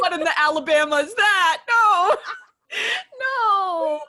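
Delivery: loud, high-pitched cry of disbelief; appalled outburst. Real, not acted. Co-host reacting to an OnlyFans family joke, appalled outburst. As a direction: exclaim in laughing horror.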